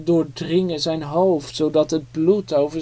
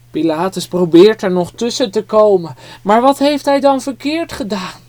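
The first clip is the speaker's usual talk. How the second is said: usual speaking voice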